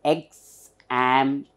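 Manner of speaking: EXAMPLE in three slow pieces: eks, am, pl; long pauses inside "Example"; syllable by syllable. Shown as a demonstration. Teacher demonstrates three syllable word, syllable by syllable. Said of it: "Three syllable word".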